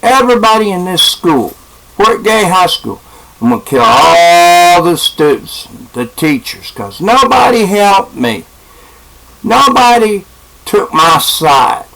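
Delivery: imitating an aggrieved rant, pitched high; acted-out threat. Boy with a gun threatening the whole school, acted-out aggrieved rant.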